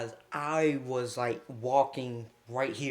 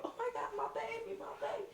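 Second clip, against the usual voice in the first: very high, soft, quick put-on voice; mock panicked girlfriend; 13 dB quieter, much faster than usual. Girlfriend rushing to knocked-out partner, mock panic. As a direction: imitate a distraught girlfriend in a tiny falsetto.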